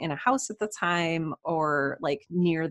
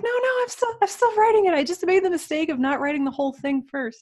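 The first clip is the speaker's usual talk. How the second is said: much higher, louder, quoting her own answer; high-voiced retelling of herself; 12 semitones higher, 5 dB louder, faster than usual. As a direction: put on a high, insistent voice to quote yourself. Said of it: "and I'm like".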